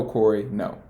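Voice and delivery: usual speaking voice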